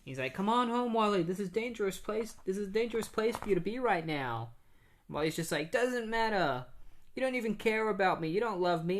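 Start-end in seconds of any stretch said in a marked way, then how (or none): 0.3-4.5 pleading dad. Retold or imitated. 5.7-9.0 put-on higher, hurt teenager lashing out at dad; hurt defiance. Boy defies father in storm.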